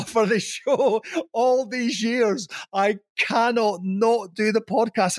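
high, drawn-out melodramatic plea; mock anguish. Former host vowing loyalty to the podcasters, hammed-up anguish. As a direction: agonise grandly over each word, slow and pitched high. Retells his agonised vow about podcast.

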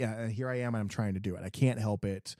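usual speaking voice

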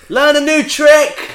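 high, loud, drawn-out call; mock heckle. Heckling the Queen for a laugh, high mock shout.

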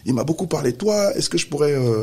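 high, eager polite mother; grateful mother. Retold or imitated. Mother takes the phone to thank him, high and eager. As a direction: gush politely like a thankful mum. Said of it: "sa mère prend le téléphone".

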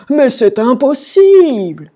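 high, loud, drawn-out despair; wailing king. A narrator's reading. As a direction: wail that all is hopeless. Roi qui se lamente. (lamentation désespérée)

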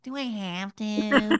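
put-on slow, drawn-out childish whine; whiny kid voice. Shown as a demonstration. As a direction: drag it out like a reluctant child. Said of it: "in a grown-up voice, not like".

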